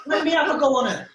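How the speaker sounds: put-on high, loud drunken demand; imitated drunk mate. Drunk mate asking his pal for a turn, imitated drunken demand.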